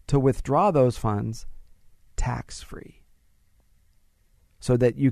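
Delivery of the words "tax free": whispered tax-free. Shown as a demonstration. Host confides to listeners, whispering tax-free.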